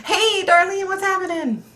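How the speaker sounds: high, sing-song "hey there" voice; sing-song greeting. Pretend. Streamer greeting viewer Darlene in a high cheery voice.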